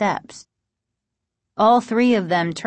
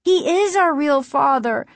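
high-pitched voice punching "is"; firm, high girl's insistence; insistent; 7 semitones higher, 3 dB louder than usual. A narrator's reading. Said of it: "Margaret insisted".

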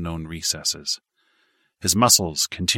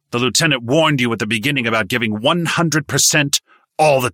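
higher, louder, angry lecture; stern berating; 5 semitones higher, 6 dB louder than usual. A narrator's reading. Instructor scolding recruits for half-hearted training, angry lecture. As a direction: chew them out like a hard sergeant.

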